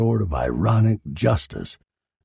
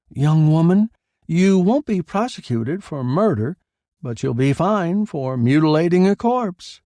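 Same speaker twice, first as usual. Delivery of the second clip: a hint of a stern official, raised and louder; lightly voiced coroner; 8 semitones higher, 4 dB louder than usual. Coroner speaks.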